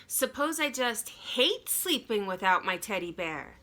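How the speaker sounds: plain reading voice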